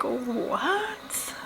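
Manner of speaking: breathless whispered what; stunned, whispered disbelief; shocked whisper. Retold or imitated. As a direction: gasp it out under your breath. Shocked reaction to her admission.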